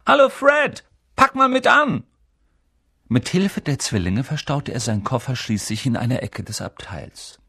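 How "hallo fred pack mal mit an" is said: high, loud holler to a brother; shouting for help. A narrator's reading. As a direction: yell over to fetch him.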